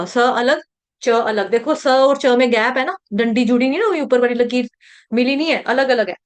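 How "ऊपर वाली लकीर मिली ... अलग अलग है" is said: usual speaking voice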